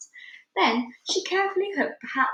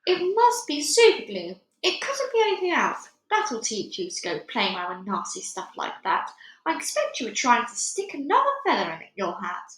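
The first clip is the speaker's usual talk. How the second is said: nagging wife; 3 semitones higher, 3 dB louder than usual. Acted. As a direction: scold him like a know-it-all wife. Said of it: "Missus Wormwood said to him".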